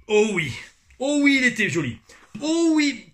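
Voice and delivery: oh oui lancé très haut et très fort; extase. Real, not acted. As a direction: savoure bruyamment en criant. Extase sur la boisson. (oh oui exalté, très haut et fort)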